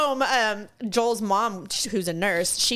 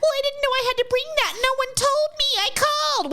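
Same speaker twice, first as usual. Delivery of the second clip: very high, mocking whine; whiny complainer; 14 semitones higher than usual. Unprepared customer protesting to DMV staff, high whine. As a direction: imitate an indignant, helpless customer.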